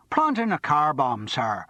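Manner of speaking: a joking put-on Irish voice; Irish accent. A narrator's reading. Martin jokes to Charles in mock Irish. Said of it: "dropped suddenly into an Irish accent".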